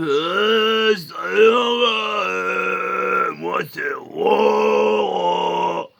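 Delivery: hammed-up dopey tattoo artist introducing himself; dopey tattooist voice. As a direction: play the tattooist as a slow, dim-witted character.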